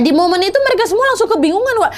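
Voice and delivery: ordinary delivery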